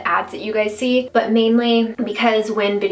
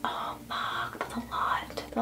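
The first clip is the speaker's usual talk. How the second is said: whispered swear; 13 dB quieter than usual. Real, not acted. Reacts to too much eyeshadow.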